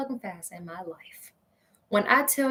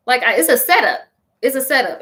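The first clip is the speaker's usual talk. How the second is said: usual speaking voice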